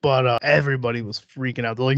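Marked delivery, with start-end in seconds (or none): none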